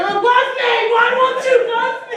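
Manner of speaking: high, loud needy wail; desperate whining plea. Retold or imitated. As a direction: beg for love like a clingy kid. Whining plea to uninterested guy.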